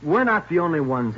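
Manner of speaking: loud, high-pitched denial; desperate insistence. Acted. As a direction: protest with rising panic. Suspect insisting under interrogation.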